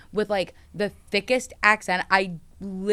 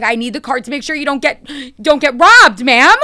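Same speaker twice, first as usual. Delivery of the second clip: exasperated shouting, higher and much louder; mock angry scammer; 7 semitones higher, 15 dB louder than usual. Scammer getting mad at the caller stalling them, mock furious shout. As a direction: yell it like a scammer losing patience. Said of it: "they're getting so mad".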